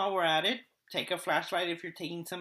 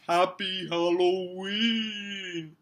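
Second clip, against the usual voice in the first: slow drawn-out spooky Halloween; 6 dB louder, much slower than usual. Pretend. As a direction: stretch it out like a ghost. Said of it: "Spooky voice".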